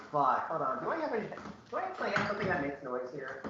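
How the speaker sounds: echoing as if in a cave. Real, not acted. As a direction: talk with a cavern echo. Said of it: "sound effect that's making you sound like you're in a cave".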